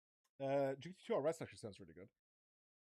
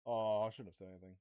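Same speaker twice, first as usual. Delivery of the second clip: ordinary talk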